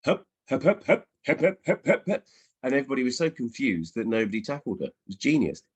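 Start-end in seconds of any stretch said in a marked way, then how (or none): 0.0-2.2 rising, higher bouncy hup hup chant, a laugh breaking in; daughter's dribbling hup. Retold or imitated. Mum imitating her daughter jumping over the ball, higher playful hups. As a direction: chant hup on each hop over the ball like a five-year-old. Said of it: "going, Hup".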